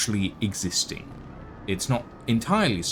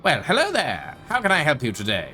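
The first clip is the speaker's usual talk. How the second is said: higher, quicker chirpy help-desk greeter; hologram librarian greeting; 6 semitones higher, 4 dB louder than usual. Acted. Hologram librarian welcoming a visitor to the library, chirpy host voice. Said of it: "standing on it that goes".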